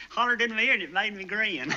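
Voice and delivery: ordinary talk, nothing marked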